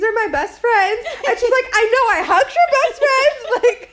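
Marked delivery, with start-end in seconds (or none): 0.0-1.1 gushing, high and loud; excited. Retold or imitated. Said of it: "The excitement was so real". 1.7-3.9 high, loud, drawn-out gushing; excited. Retold or imitated. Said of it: "There was so much excitement".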